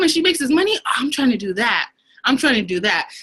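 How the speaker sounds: high, eager imitation of her younger self; mock excited. Admiring the successful leader, in a high eager voice. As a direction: gush about the leader, pitched high. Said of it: "I was like".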